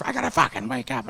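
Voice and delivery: high, squeezed mock panic; imitated freak-out. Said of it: "she's freaking out".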